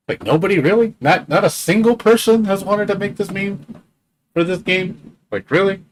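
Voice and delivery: high, disbelieving rant; incredulous. Real, not acted. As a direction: sound baffled that nobody bothered. Incredulous no one made the meme.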